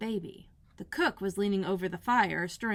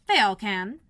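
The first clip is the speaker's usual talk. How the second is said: much louder, curt; blunt Duchess; 9 dB louder than usual. Acted. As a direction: snap it back.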